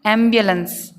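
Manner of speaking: एम्बुलेंस as "AM-byuh-luhns"; teacher's model "AM-byuh-luhns"; sounds combined. Shown as a demonstration. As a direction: blend b and y inside एम्बुलेंस. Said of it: "तीनों मिला करके बोलेंगे".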